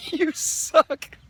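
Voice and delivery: high laughing taunt; laughing jab. Real, not acted. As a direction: laugh it out as a jeer.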